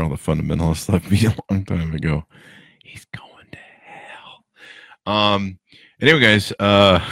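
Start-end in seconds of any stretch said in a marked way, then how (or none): 2.8-4.4 slow hushed whisper, a mock sinister aside. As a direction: whisper it like a secret judgement. Whispered aside about himself.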